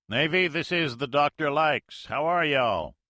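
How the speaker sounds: put-on a Russian major faking a hearty Mississippi accent. Russian major fakes Mississippi accent to Navy pilot, hearty. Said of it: "The major's Mississippi accent".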